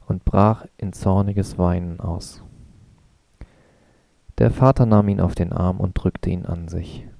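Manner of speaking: plain reading voice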